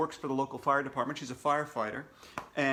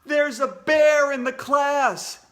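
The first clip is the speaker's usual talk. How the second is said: loud, higher, slow alarmed shout; 10 semitones higher, 10 dB louder, slower than usual. Acted. Ryan warning the class about the bear, loud shout. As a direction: yell the warning in panic. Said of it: "shouted Ryan".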